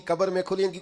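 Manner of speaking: ordinary talk, nothing marked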